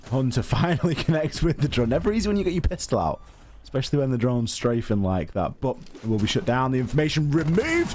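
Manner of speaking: high, quick play-by-play call; esports caster. Acted. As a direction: call the action live as it happens. Live play-by-play of the round.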